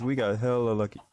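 ordinary talk, nothing marked

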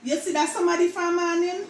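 light patois question; Jamaican patois. Shown as a demonstration. Vlogger acting out a patois example line. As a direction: ask it Jamaican style, somadi. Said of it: "words that Jamaican mispronounce".

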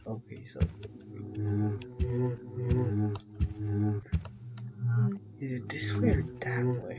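hushed sneaking whisper. Real, not acted. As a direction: whisper so nothing hears you. Sneaking through dark horror level.

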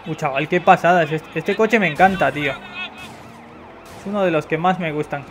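normal talking voice

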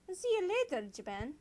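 high singsong farewell; high-pitched mock goodbye; playful put-on goodbye. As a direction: lilt it high and teasing.

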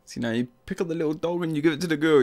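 normal talking voice

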